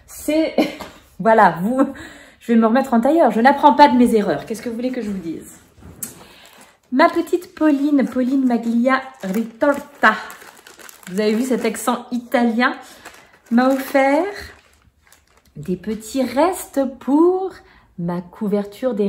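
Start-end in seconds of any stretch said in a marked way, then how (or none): none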